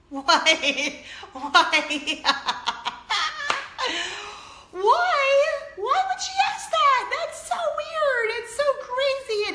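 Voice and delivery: very high, loud, laughing why; incredulous squeal. Real, not acted. Mother asking the viewers why her daughter said it, squealing high. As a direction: squeal in disbelief.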